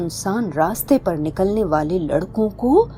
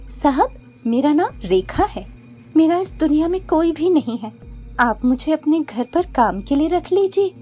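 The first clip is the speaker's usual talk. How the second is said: put-on high, humble pleading girl voice; 6 semitones higher than usual. Witch posing as Rekha begging Mohan for work, high and humble.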